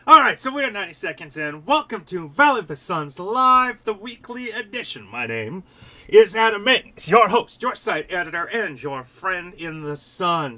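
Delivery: loud, announcing welcome, slowing at the end; booming host welcome to viewers; show host intro. Acted. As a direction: open the show like a broadcaster. Host's formal show intro.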